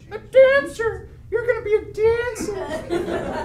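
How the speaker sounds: high, tiny put-on inner voice; little voice. Would-be dancer voicing an inner cheerleader, little voice. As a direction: squeak it as the little voice in your head. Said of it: "I hear a little voice that goes".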